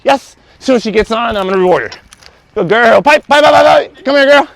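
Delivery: high, loud, gushing dog praise; animated praise. Real, not acted. Trainer praising dog on the mat, high and excited. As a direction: cheer the dog on like she just won. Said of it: "You're so animated".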